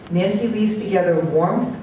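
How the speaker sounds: ordinary talk, nothing marked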